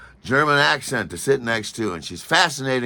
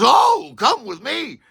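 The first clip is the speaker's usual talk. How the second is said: brisk helper; 6 dB louder, slower than usual. Retold or imitated. Airport woman takes charge.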